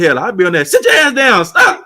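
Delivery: loud, rising mock command to sit down; hammed-up yell at the kids to sit; retelling his own mock yell at the kids; mock stern yell. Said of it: "I said".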